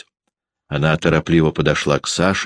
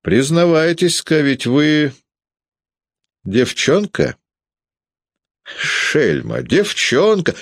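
higher, mocking sing-song; teasing; 11 semitones higher, slower than usual. A narrator's reading. Peredonov teasing Sasha that he's a girl, mocking. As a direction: taunt the boy playfully.

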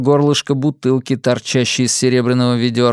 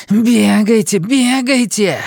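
high snarling growl, slowing down; growling dog voice; 9 semitones higher, 3 dB louder, slower than usual. A narrator's reading. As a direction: snarl it at the critters. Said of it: "прорычала она".

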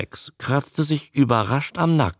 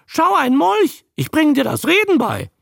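high, loud creature squawk; high-pitched parroting croak; parroting newt; 4 dB louder than usual. A narrator's reading. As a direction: chatter back learned phrases as a newt. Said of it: "brummte der Molch".

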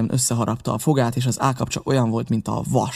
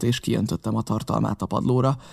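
ordinary delivery

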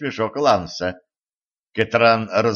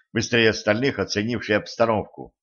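plain reading voice

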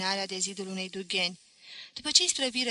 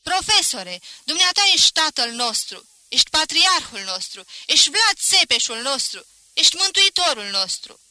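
high, loud, mounting adulation; howled acclaim; 6 semitones higher, 10 dB louder than usual. A narrator's reading. Students acclaiming their professor, howled praise. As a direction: yell each title as a fresh cheer. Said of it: "se apucară să urle și ei".

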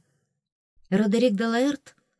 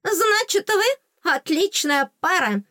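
high, drawn-out teasing fairy voice; gloating fairy; 11 semitones higher, 4 dB louder, slower than usual. A narrator's reading. Fairy teases heroine about the prince, high gloating. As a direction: needle her in a high little fairy voice. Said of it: "противно захихикала фея".

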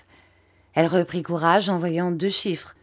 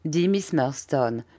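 ordinary delivery, nothing marked

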